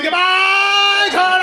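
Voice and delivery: high, loud crazed farewell; psychopath yell. Retold or imitated. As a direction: scream it like a madman. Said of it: "I yelled like a psychopath".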